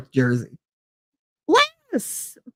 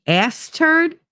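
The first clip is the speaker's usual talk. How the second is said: mock name; 11 semitones higher than usual. Mocking the baby name.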